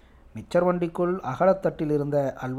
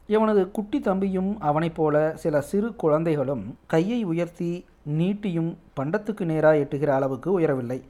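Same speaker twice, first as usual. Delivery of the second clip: usual speaking voice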